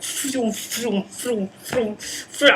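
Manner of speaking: high revving engine sounds; vroom noises. Retold or imitated. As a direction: make rushing car noises. Imitating action movie noise.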